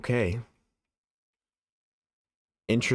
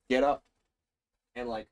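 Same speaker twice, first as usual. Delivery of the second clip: ordinary delivery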